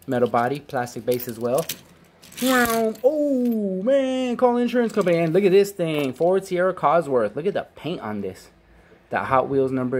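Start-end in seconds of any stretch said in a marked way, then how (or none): 2.4-7.4 high, gushing wow; thrilled at the car; excited. Real, not acted.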